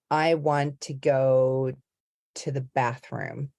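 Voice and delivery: slow, careful American accent; word-by-word American vowels. Shown as a demonstration. Coach showing single words in a sentence, slow American accent. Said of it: "with an American accent".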